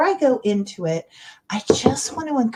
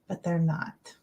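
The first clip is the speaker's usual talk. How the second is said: ordinary talk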